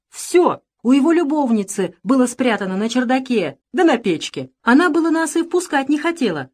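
a touch of eager, agitated servant; excited servant reporting. A narrator's reading. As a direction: pour out the report to the master.

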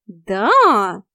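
exaggerated mock astonishment; feigned surprise. A narrator's reading. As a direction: act amazed in one stretched word. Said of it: "притворно удивилась я".

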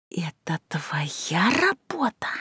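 slow angry hiss; hissing whisper. A narrator's reading. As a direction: hiss it slowly through the teeth. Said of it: "зашипела".

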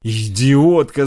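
slow, loud furious yell; shouting. Acted. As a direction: scream the insult at her. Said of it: "закричала Инг". (яростный крик)

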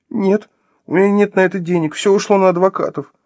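high, whining excuse; plaintive confession. Acted. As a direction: admit it pitifully, in a high voice. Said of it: "жалобно призналась она".

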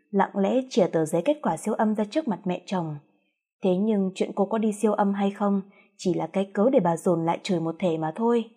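ordinary delivery

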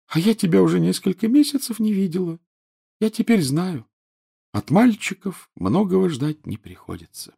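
high, reproachful schoolgirl ghost; whiny ghost girl. A narrator's reading. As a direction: sulk like a neglected ghost girl. Narrator voices Myrtle.

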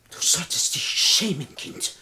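whispered scolding of a betrayer; breathy, shaming whisper; hushed reproach. Acted. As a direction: whisper it with shocked disapproval.

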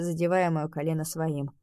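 plain reading voice

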